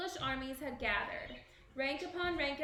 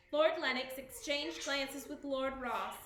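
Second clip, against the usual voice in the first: plain reading voice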